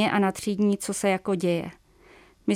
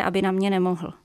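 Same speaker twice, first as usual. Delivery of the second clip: plain reading voice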